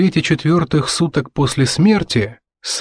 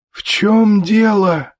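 high, slow wheezy wife; slow, high undead croak; wheezing; 9 semitones higher, much slower than usual. A narrator's reading. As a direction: wheeze it out, long and drawn. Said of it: "просипела Дженни со своего угла".

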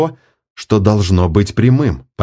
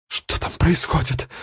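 whispered; 7 semitones higher, 7 dB quieter than usual. A narrator's reading. Blinded woman wonders tearfully.

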